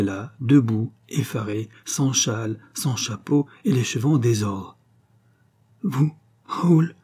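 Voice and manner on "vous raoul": high-pitched startled cry; exclaiming. A narrator's reading. Wife caught unawares by her husband, exclaiming. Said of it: "exclama-t-elle".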